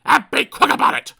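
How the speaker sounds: loud, rude order; snapped. Acted. Mister Grumpy ordering the butcher about, loud and rude. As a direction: bark at the butcher. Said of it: "snapped Mister Grumpy".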